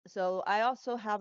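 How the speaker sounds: normal talking voice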